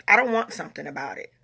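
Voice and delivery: normal talking voice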